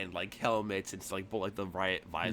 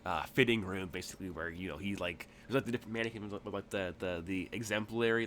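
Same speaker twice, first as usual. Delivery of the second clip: ordinary talk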